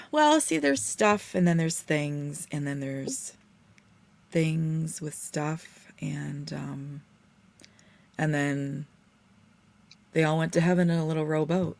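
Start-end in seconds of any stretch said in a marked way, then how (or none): 0.1-8.9 drawn out. Pretend. Mock-wise rambling explanation.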